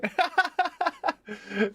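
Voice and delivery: a quick, high-pitched giggle; high laugh. Real, not acted. Streamer thanked by a viewer, high giggle. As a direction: let a sudden high laugh slip out.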